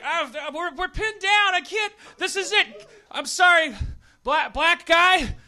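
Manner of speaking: high, loud panicked soldier; pinned-down soldier. Acted. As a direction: plead like a doomed soldier under fire.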